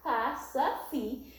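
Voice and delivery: "capacity" slowly, in four separate beats; syllable by syllable. Shown as a demonstration. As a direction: sound out "capacity" as ca-pa-ci-ty. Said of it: "four syllables".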